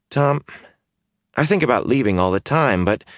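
suddenly worried suspicion; anxious young man. Acted. Mat's voice, uneasy.

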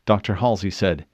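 usual speaking voice

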